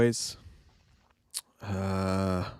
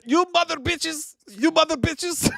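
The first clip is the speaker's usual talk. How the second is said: much higher, shrill-pitched mock yell; imitating movie thug. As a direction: squeak out the insult like the thug. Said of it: "he said, he said".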